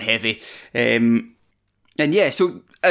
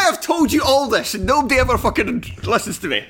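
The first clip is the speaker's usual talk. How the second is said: exasperated; 8 semitones higher than usual. Acted. Continues mock rant.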